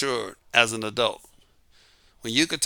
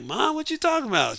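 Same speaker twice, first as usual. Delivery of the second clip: lightly voiced boy, pitched way up; young son's voice; 12 semitones higher, 4 dB quieter than usual. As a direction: ask it like a puzzled ten-year-old. Boy questioning his mother.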